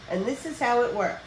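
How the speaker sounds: plain reading voice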